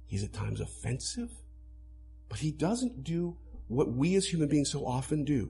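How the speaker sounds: ordinary talk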